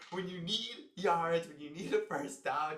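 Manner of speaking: slow, swelling excitement; excited fan. Real, not acted. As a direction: hype up the runner. Fan hyping his player.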